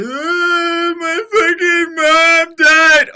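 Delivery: high, loud fake wailing cry; mock sobbing wail. Streamer parodying edgelord breaking down, hammed-up wail. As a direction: ham up a sob, slow and shrieking. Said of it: "You're like".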